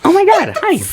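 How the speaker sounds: very high, loud mock-girly squeal; bubbly girl voice. Girl greeting him as he retells it, exaggerated bubbly falsetto. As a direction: imitate a gushing girl in falsetto. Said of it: "she was like".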